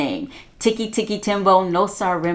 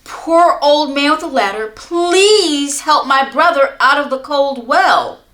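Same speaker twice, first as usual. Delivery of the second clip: pleading; 6 semitones higher, 5 dB louder than usual. Acted. Boy pleading with old man.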